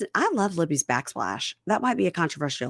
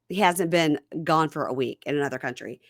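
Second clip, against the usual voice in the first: ordinary talk, nothing marked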